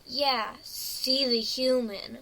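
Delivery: usual speaking voice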